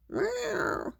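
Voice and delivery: small, high mewl; imitated cat meow. A narrator's reading. Kitty getting water poured on her, soft high meow. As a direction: mew softly and very high. Kitty protests being soaked.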